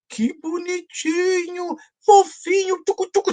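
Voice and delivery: put-on a muscly man whose voice melts at a baby; melting baby talk. Muscular man in the museum coos at the baby, high-pitched baby talk. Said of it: "a voz de um cara musculoso ficava assim".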